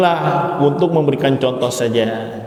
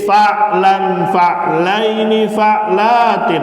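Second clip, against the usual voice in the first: slow, higher teacher chant with long pauses; slow recitation drill; 4 semitones higher, much slower than usual. Shown as a demonstration. Teacher leading students through a drill, slow, raised chanting voice. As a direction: lead the class through each form so they can repeat it.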